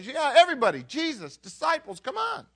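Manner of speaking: high, loud acted invitation; calling guests in. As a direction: wave everyone in with a rising, eager call. Acts Simon inviting everyone in.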